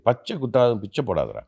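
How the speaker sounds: rattled off fast, teasing; tossing off grandma's proverb in a quick, playful tone; joking proverb. Retold or imitated. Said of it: "My grandmother used to jokingly say".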